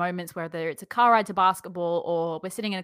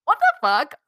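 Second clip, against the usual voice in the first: louder, higher stunned what the fuck; shocked disbelief; 7 semitones higher, 8 dB louder than usual. Retold or imitated. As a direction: react to the spam email in disbelief. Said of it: "And I was like".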